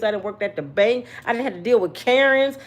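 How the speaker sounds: ordinary talk, nothing marked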